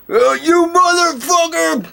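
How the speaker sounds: high, slow, drawn-out hammed-up bellow; mock far-off yell. Game master voicing Jake heard from the cafe, mock yell. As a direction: holler it from outside. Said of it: "you just hear from outside".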